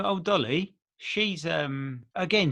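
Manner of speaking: ordinary talk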